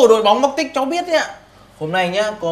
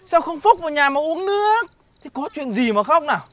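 normal talking voice

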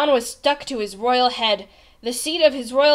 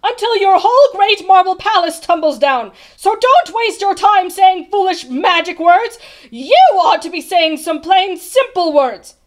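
high, loud scolding tirade; shouted; 5 semitones higher, 10 dB louder than usual. A narrator's reading. Bartholomew tells off the king about magic words, shouting. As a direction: shout him down, hammering each phrase. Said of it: "he shouted".

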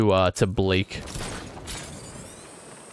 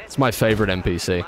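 normal talking voice

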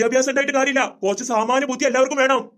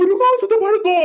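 high, loud, startled question; shocked disbelief; 6 semitones higher, 5 dB louder, slower than usual. Acted. Customer shocked to hear the sweets are a month old, loud disbelief. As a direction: blurt it back in disbelief.

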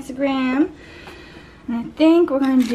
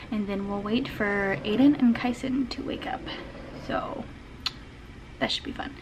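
whisper; 3 semitones lower, 9 dB quieter than usual. Real, not acted. Kids still asleep, keeps voice down.

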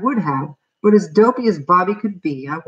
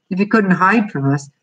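ordinary delivery

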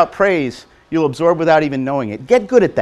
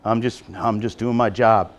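mock modest worker; 7 semitones lower than usual. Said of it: "this thing about".

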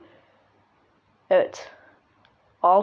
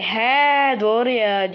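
long drawn-out mock agreement; mimicked realisation; much slower than usual. Pupil mimics what the teacher says, slow drawn-out mockery. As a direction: stretch it very slowly, mimicking.

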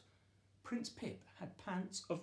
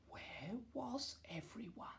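hushed whisper in the empty palace; 8 semitones higher, 9 dB quieter than usual. A narrator's reading. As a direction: breathe it almost silently. Quiet palace, nobody around.

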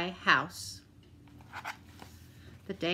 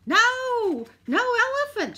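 high, loud shout of no; Grandma refuses the elephant outright; shouted refusal; 7 dB louder than usual. A narrator's reading. Grandma refusing the pet man, high loud shout. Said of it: "shouted Grandma".

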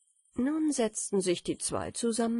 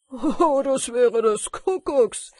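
very loud, higher "oh" and exclamation; exclaimed call; 5 semitones higher, 10 dB louder, slower than usual. A narrator's reading. Miller hears of roast in the stove, exclaiming loudly. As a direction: burst out loudly, high-pitched. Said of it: "rief der Müller".

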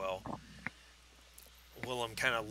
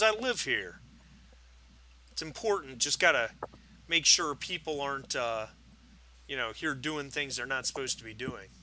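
lightly voiced tipsy neighbor, pitched up; defensive drunk; 2 semitones higher, 10 dB louder than usual. Drunk neighbor explains himself to detectives, mildly defensive. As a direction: protest with a touch of drunken defensiveness.

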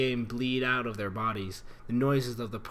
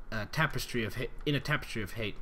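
ordinary delivery, nothing marked

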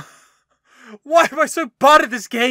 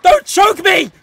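loud panicked yelling while being grabbed; panicked shout; 4 semitones higher, 7 dB louder than usual. Real, not acted. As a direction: yell in real panic, fast. Attacked by enemy in game.

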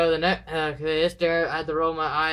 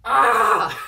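sudden sharp yell of real pain, much higher and louder; pain scream; 6 semitones higher, 9 dB louder than usual. Real, not acted. Vlogger drops an object on own foot, real pain yell. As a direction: cry out as the object hits your foot. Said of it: "That hurt a lot".

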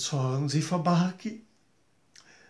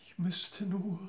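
whisper; 3 semitones higher, 8 dB quieter than usual. Acted. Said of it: "whispered request".

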